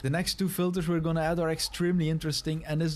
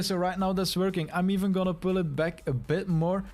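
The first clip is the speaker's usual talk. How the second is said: ordinary talk, nothing marked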